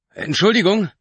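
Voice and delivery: high, loud call for attention. A narrator's reading. Mark calling to the taxi driver, loud and high. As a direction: call forward to get noticed.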